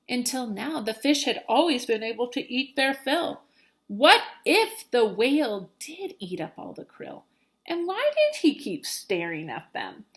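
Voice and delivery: usual speaking voice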